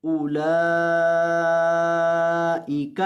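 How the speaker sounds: slow, drawn-out tajweed chant; Quran recitation. Shown as a demonstration. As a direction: recite each word stretched and melodic.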